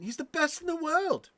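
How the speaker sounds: high-pitched, badly faked Scouse; bad Scouse accent. Streamer quoting James Redman's catchphrase to chat, bad high Scouse accent. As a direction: put on a hopeless Scouse squeal. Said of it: "Can't do a Scouse accent to save my ass".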